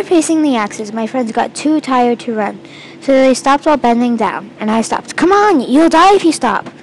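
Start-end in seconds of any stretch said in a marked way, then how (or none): 5.2-6.7 high, loud plea; urgent yell. A narrator's reading. Girl urging tired friends past spinning axes, urgent yell. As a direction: yell to get them running. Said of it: "I yelled at them".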